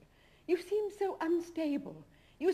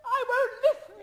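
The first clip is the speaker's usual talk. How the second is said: loud, high cry of refusal; refusing shout; 11 semitones higher, 8 dB louder than usual. Acted. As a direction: shut him out with a loud cry. She refuses in quarrel.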